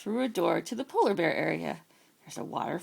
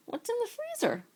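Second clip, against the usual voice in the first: put-on high, eager young bear; a greedy little bear asking, pitched up. Irving the bear asks Roy, high and eager.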